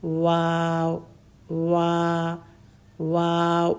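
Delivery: واو with lips fully rounded into a circle; rounded lips demo. Shown as a demonstration. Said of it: "کیسے گول کیے ہوئے ہیں". (واو ہونٹ گول کر کے)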